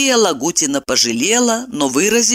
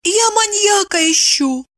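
very high, timid wifely voice; timid confession. A narrator's reading. Wife confesses her search to husband, timid. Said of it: "робко призналась она".

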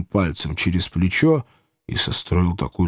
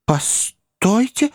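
much higher, slow drawn-out «Постойте!»; Pickwick interrupting; 12 semitones higher, much slower than usual. A narrator's reading. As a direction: hold them back with «Постойте!» high and long. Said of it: "вмешался мистер Пиквик". (высокое протяжное «Постойте!»)

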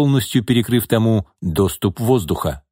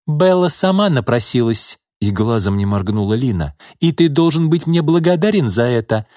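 cold and scornful, higher; cold scornful mistress; 5 semitones higher than usual. Acted. Lina voiced, high.

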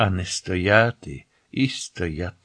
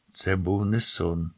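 mildly icy, softer voice; 2 semitones lower, 6 dB quieter than usual. A narrator's reading. Narrator telling her friend it was no dream, icy. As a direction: say it with a quiet, understated chill. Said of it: "сказала я крижаним голосом".

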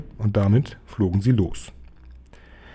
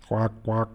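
two short, soft duck quacks; imitated quacks; 5 dB quieter than usual. Bird answering who is on the phone, imitated quacks. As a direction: quack twice, quietly, like a duck.

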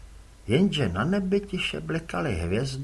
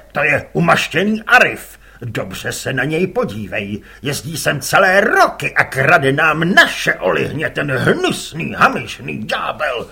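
louder gruff grumbling rant; gruff angry father; 9 dB louder than usual. Acted. Father scorns the rival fisherman, gruff anger. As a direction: growl contempt at the rival. Father rants about Arif.

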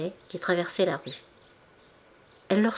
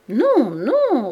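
louder, higher insistent no; stubborn refusal; 6 semitones higher, 7 dB louder than usual. A narrator's reading. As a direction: dig in like a know-it-all customer. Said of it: "répétait-elle, avec l'entêtement".

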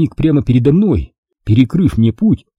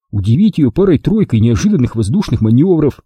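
ordinary delivery

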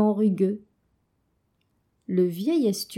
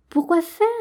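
put-on higher, louder childlike why; puzzled question; 7 semitones higher, 5 dB louder than usual. Adèle asks the fish why, higher and puzzled. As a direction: ask it in wide-eyed wonder. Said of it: "demanda Adèle".

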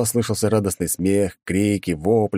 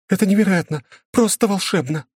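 delighted; 14 semitones higher than usual. Acted. Directress delighted.

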